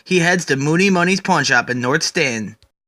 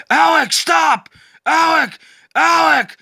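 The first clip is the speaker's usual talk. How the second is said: high shouted calls, much louder; yelling; 11 semitones higher, 4 dB louder, slower than usual. Acted. Batman shouting at Swamp Thing to stop. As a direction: call out desperately to stop him. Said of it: "yelling out several times".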